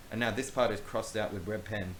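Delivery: usual speaking voice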